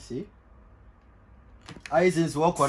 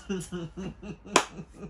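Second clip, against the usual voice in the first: hearty laugh; 13 dB quieter than usual. Real, not acted. Friends joking about a burglar.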